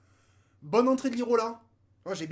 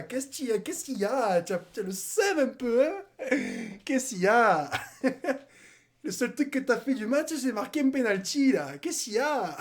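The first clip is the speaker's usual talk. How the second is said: teasing with laughs breaking through; taunting laughter at Lyon; laughing mockery; 2 semitones higher than usual. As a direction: giggle while you needle them. Taunting rival fans, laughing.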